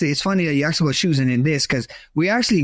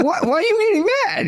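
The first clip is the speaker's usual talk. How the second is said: laughing disbelief; faster than usual. Retold or imitated. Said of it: "I was like".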